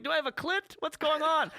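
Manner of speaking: high, startled question; surprised. Retold or imitated. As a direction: pretend shock at the sensation. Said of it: "You're like".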